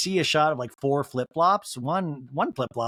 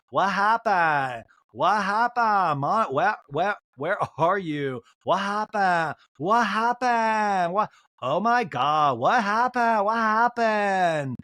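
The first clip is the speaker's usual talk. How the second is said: mock frantic, slow drawn-out pleading, a bit higher; imitating frantic Lisa; 4 semitones higher, 3 dB louder, much slower than usual. Lisa calling through the door to sobbing Monica, mock frantic. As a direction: wail the question over and over like a worried friend.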